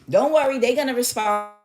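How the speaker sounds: high-pitched placating coach; hammed-up false comfort; mock reassurance. Mimics coaches' empty reassurances.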